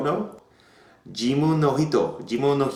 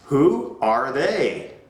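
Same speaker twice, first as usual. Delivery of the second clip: slow drilled question; 3 semitones higher, much slower than usual. Shown as a demonstration. Drilling the class.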